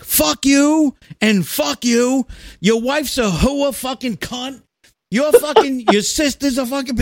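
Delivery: a winner cursing out his whole office, gloating; mock furious tirade. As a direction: rant at everyone like you're finally free.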